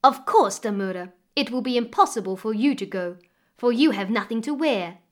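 loud, cruel stepmother's taunt; unkind. Acted. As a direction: sneer it at the girl. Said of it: "said the stepmother unkindly".

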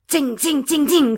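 high, ringing tings; imitated bullet hits. A narrator's reading. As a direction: patter them like bullets striking.

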